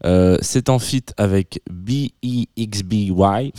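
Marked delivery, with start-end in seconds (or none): 1.7-3.5 slow, spelled-out name in an English accent; French radio host spelling a name with an English accent. Real, not acted. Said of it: "ne me tenez pas rigueur, s'il vous plaît, de mon accent anglais".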